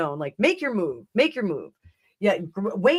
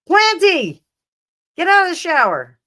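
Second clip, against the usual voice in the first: mock call to Planty; 8 semitones higher, 9 dB louder than usual. Calling the plant out of shower.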